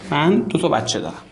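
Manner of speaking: usual speaking voice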